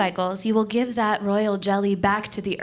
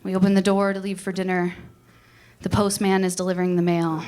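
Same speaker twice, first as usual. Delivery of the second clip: ordinary talk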